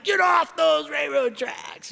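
imitating an angry driver's slow, high shout; retold yell. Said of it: "he starts yelling".

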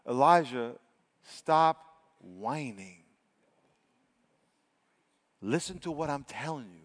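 low, slow, soft reprimand; quiet rebuke. Acted. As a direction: hush down and space it out firmly. God's reply to Elijah, acted.

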